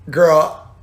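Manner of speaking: incredulous retort. Retold or imitated. Drag queen answering a coworker, mildly incredulous. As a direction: lift the voice in disbelief.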